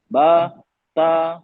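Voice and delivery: बा, ता said with the mouth half open and the aa held. Shown as a demonstration. Teacher shows the zabar sound with a half-open mouth. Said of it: "आधा मुंह खोलेंगे".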